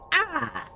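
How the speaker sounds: high cry on hitting the ground; pained cry. Acted. Pirate plunking down into the ground, pained cry. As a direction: yelp as the fall lands. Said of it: "He takes three falling damage as he".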